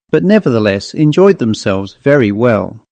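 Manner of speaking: plain reading voice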